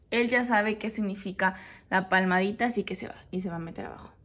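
normal talking voice

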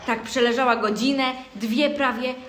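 ordinary talk

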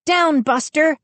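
high, urgent command; ordering. A narrator's reading. Mindy commanding the dog, ordering. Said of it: "Mindy ordered".

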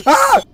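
sharp high scream of fright; startled scream. Real, not acted. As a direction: yelp in sudden fright. Sudden scare in horror game.

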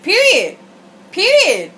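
loud, high, punchy Period; emphatic. Real, not acted. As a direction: slam it down, final word. Emphatic sign-off on opinion.

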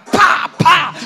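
high, loud pa pa of blows; mock hitting sounds. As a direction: smack it out like a handbag hit. Golpes con la cartera. (golpes imitados, agudos y fuertes)

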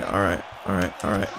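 low, soft repeated reassurance; quiet self-soothing under the breath; steadying oneself. Real, not acted. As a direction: drop right down and talk yourself calm. Steadying himself after taking hits.

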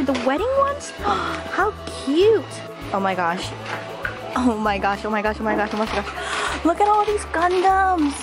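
ordinary talk

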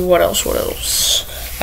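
unvoiced, slow search under the breath; whispering to self. Real, not acted. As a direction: whisper while hunting through the list. Searching a list, muttering.

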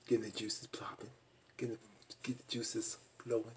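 hushed, breathy whisper. Real, not acted. Vlogger teasing about the couple, whispering. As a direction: drop to a secret whisper.

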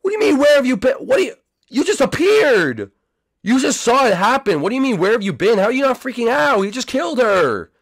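high, exasperated disbelief; high-pitched incredulous rant; incredulous outburst. Real, not acted. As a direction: demand an explanation, voice pitched right up. Incredulous outburst at character's question.

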